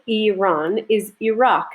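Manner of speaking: Iran as "ih-RAHN", Iraq as "ih-ROCK", said slowly; native-style pronunciation. Shown as a demonstration. Lecturer models country names for students, careful pronunciation of Iran and Iraq. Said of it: "Notice how I'm pronouncing these".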